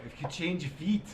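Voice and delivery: movie line in mock Scottish; Scottish accent. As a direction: quote it with an attempted Scots accent. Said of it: "it's that line from Brave".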